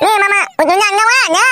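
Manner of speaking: put-on high, pleading child's voice; whiny little boy. Small son begging father for big doll, whining. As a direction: beg like a spoilt kid.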